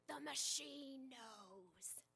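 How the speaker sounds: very slow, eerily calm pronouncement; serene blind faith. Retold or imitated. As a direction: say it like an oracle, unshaken. Michael trusts GPS blindly.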